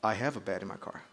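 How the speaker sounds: low, hushed mock British haughtiness; put-on superiority, very soft and low; very arrogant. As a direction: play the arrogant Rolls driver, quiet and deep. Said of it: "like, very arrogantly".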